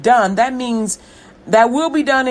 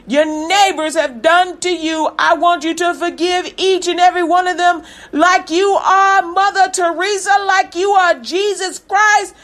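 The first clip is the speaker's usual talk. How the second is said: yelling; 5 semitones higher than usual. Real, not acted. Preacher shouting at the congregation to forgive, full-force yell. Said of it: "Am I yelling?".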